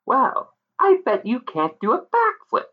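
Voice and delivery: high taunting fairy voice; pixie voice. Acted. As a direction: dare him, sly pixie.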